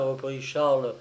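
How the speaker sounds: usual speaking voice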